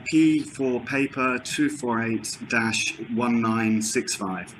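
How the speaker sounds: slow, spelled-out reading back; slow dictation. Real, not acted. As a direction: read each digit slowly and carefully.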